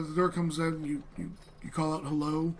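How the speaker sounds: normal talking voice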